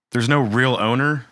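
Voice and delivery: normal talking voice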